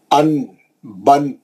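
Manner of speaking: slow, stretched tanween syllables an, bin; drawn-out recitation. Shown as a demonstration. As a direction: sound out ان and بن slowly with a held nasal n. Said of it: "تنوین لگائی".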